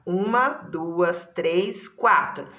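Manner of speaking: Uma, duas counted out slowly, one beat each; slow counting. Shown as a demonstration. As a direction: count the syllables for the class. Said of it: "Quantas sílabas tem essa palavra?".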